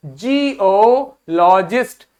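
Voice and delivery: Geologist spelled out slowly syllable by syllable; slow teacher demo of geologist; slow model word. Shown as a demonstration. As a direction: say it slowly for the class to repeat. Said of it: "बोलो मेरे साथ".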